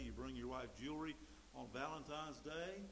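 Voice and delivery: ordinary delivery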